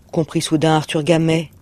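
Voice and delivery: ordinary delivery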